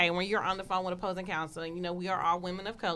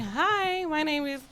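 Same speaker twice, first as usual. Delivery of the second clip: higher, bright put-on phone voice; 8 semitones higher, slower than usual. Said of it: "he switched it and was like".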